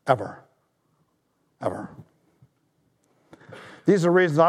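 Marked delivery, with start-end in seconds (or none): 1.6-1.9 hushed echo. Real, not acted. Emphatic repeated single word.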